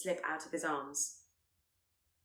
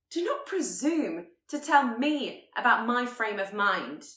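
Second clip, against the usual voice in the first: higher and louder, indignant snap; angry retort; 5 semitones higher, 8 dB louder than usual. Acted. Elizabeth rounding on Darcy as he leaves, angry. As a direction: she refuses to be dismissed. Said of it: "Even in her anger".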